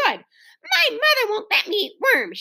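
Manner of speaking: put-on high, slow little-boy shout. A narrator's reading. Little boy backs away from cookies, shouting. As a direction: call it out high, in a child's voice. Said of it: "shouted a little boy".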